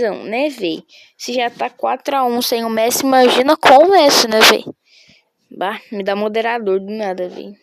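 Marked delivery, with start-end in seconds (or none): 3.1-4.6 loud football-fan outburst; excited shout. Real, not acted. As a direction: raise it to a yell, worked up. Excited shouting about the match.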